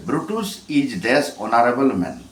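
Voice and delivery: English question read out very slowly, word by word. Shown as a demonstration. Teacher reading the exercise to students, very slowly. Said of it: "সাত নম্বরে".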